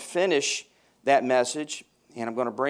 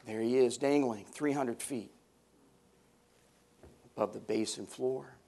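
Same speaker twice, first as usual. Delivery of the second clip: ordinary talk, nothing marked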